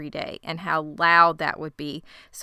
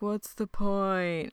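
slow, softer defeated sigh; lightly voiced despair; 5 dB quieter, much slower than usual. Cohost voicing Warren asking Lisa why bother. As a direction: drag it out hopelessly. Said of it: "And Warren's like".

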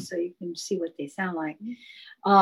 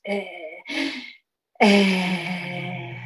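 squeezed eh from the diaphragm. Shown as a demonstration. Third chakra demo, a squeezed eh tone. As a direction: press an eh out under the ribs. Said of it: "squeezing the sound out through that".

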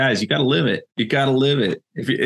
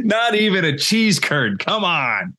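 higher, half-laughing indignation; mock outrage; 9 semitones higher, slower than usual. Real, not acted. Cohost scoffing at the French fry farewell gift, mock outraged.

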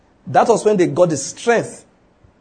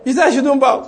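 mocking protester; 9 semitones higher than usual. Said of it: "And I say".

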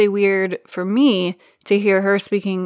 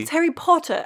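put-on failed British accent; 8 semitones higher than usual. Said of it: "How come I can't say it?".

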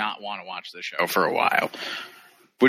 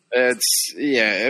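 ordinary delivery

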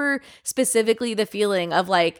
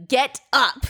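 mildly angry, raised-pitch command; retold anger; 5 semitones higher than usual. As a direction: order someone up in retold irritation. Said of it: "I was, like, so mad".

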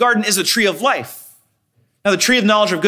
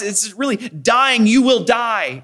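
ordinary talk, nothing marked

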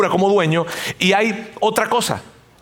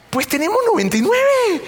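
high, singsong carefree shrug; breezy dismissal; 13 semitones higher than usual. Acted. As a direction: wave the loss away in a high mock voice. Voices owner shrugging off lost sheep. (despreocupado, con tonillo agudo y burlón)

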